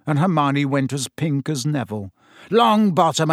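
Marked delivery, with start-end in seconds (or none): 2.5-3.3 louder, higher bark of a name; sharp call. A narrator's reading. Teacher summoning the clumsy boy, sharply. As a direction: snap the pupil's name. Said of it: "said Snape coldly".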